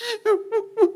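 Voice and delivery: high, thin fake sobs; mock sobbing. Preacher imitates a child scolded by father, sobbing. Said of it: "울고 있을 때".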